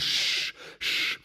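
drawn "shh" to quieten a crowd; hushing. Acted. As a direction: hush an imagined audience. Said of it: "he quietens the audience down".

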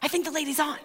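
soft, small prank-caller voices; kids whispering together. Retold or imitated. Prank callers conferring on the line, hushed. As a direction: confer in a hushed, excited undertone. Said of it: "All these voices".

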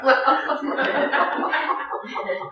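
a breathy chuckle at his own joke; a quiet, breathy laugh; soft laugh. Real, not acted.

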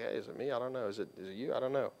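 low, hushed self-defense; defensive murmur. Acted. Disciples asking each other who the betrayer is, defensive murmur. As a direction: murmur it under your breath, deflecting blame. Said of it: "They begin to get defensive".

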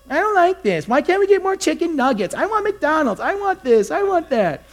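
put-on very high, whining kid voice; whiny grumbling child. Pastor imitating a child grumbling to parents, whiny. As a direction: mimic a child whining demand after demand. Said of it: "Every parent in here has heard their child grumbling".

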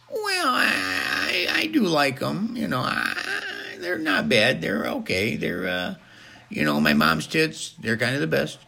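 high, strained, drawn-out hesitation; comic high-pitched stammering guest; hesitant Jimmy-type character. Acted. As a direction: squeeze out a reluctant opinion in a high voice.

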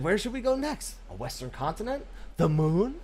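light put-on voice of the developer; lightly voiced game director asking; mocking developer voice. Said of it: "He said".

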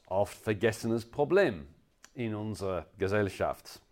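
normal talking voice

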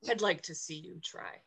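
low, soft menacing dare; quiet taunt. Acted. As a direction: murmur the challenge under your breath. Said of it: "I said".

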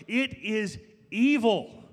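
slow and loud, "evil" drawn out; thundering preaching. Real, not acted. Pastor hammering his point to church, slow loud thunder. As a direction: drive it home slowly.

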